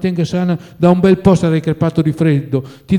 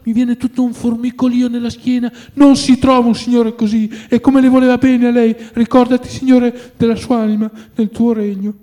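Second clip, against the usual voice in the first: put-on higher, lightly tearful old man's lament; tearful old servant; 7 semitones higher than usual. A narrator's reading. Old servant mourning his master at the grave, tearful. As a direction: grieve for the dead master in a raised, aching voice. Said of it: "aggiunse singhiozzando".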